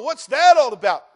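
loud, high cry of disbelief; a shouted what's that all about; exasperated shout. Acted. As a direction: shout it out in mock bewilderment. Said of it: "you go".

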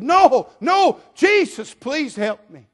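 high, loud refusal turning to pleading; desperate shouting. Acted. Blind man refusing to be hushed, desperate shouted plea. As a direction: shout over those hushing you, easing at the end. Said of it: "He's just shouting out".

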